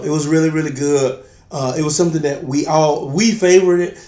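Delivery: normal talking voice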